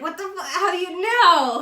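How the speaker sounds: high, loud shocked cry; surprised shout; shocked outburst. Retold or imitated. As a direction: blurt it out caught off guard.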